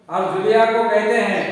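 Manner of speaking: ordinary delivery, nothing marked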